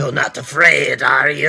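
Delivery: loud put-on taunting question; mimicked film taunt. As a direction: echo the film line, building to a shout. Loudly mimics the film clip.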